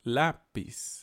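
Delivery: "Lápiz" broken in two, LÁ stronger; first-syllable stress. Shown as a demonstration. Teacher repeating the example, stress on the start of "Lápiz". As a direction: say "Lápiz" as LÁ... piz. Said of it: "la parte fuerte es la".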